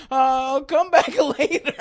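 high, loud mock-grandma voice; flustered grandmother. Grandmother walking in on grandson, high flustered imitation. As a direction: back out awkwardly, imitating grandma. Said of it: "she's like".

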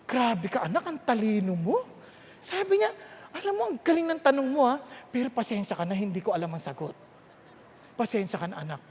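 a hint of a dad admitting he doesn't know; sheepish father. Father apologizing to his curious son, lightly voiced and soft. Father answering son's questions.

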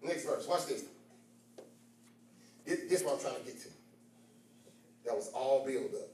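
low, soft, intimate Bible reading; quiet teaching voice. Acted. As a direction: draw them in close and low.

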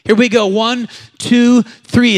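rally them on the count; loud count-in. Real, not acted. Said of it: "say it out loud".

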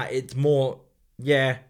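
ordinary talk

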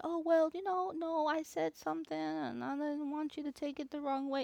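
high-pitched pleading excuse; mock defensive explaining. Podcaster imagining excusing herself to listeners, high mock-defensive.

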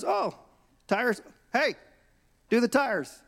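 high-pitched mock boss order; manager calling out. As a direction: call the order across to staff. Manager acted calling to his staff.

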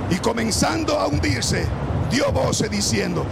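loud, high, fervent Bible reading; preaching at full force. Acted. Preacher reads to the church, loud and high-pitched. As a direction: preach the storm story to the congregation.

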